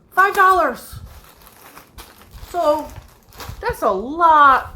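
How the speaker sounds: bargain-hunter squealing over the price; excited exclaim. Real, not acted. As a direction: shout the price in delight.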